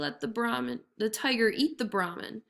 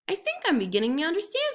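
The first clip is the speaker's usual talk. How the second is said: faster, higher feigned dawning; quick sly jackal; 9 semitones higher, faster than usual. A narrator's reading. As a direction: hide your trick.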